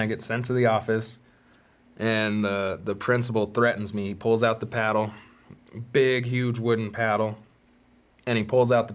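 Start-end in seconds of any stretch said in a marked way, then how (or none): none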